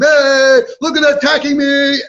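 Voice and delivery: high, loud mock outcry; hammed-up shout for attention; drunken scene. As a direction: yell out like an outraged drunk. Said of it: "what a scene".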